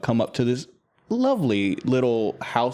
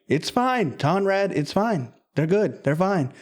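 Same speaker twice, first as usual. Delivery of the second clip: reassuring wife; 8 semitones higher than usual. Acted. Stormay reassures Conrad.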